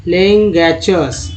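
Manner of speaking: लेंगैचर्स spoken slowly, every syllable marked; slow word demo. Shown as a demonstration. Teacher showing students a hard word, slow. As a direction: stretch out लेंगैचर्स for the class. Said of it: "next देखिए लेंडरमैन्स".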